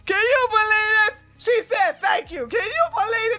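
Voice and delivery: high, loud laughing shout; cracking up. Real, not acted. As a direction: howl with laughter. Cracking up at the clip.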